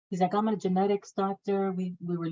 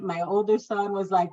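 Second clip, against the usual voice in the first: ordinary talk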